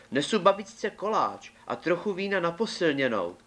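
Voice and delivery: put-on high, innocent girl's voice; little girl's voice. A narrator's reading. Girl answers the wolf in the forest, high and innocent. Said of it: "odpověděla dívka".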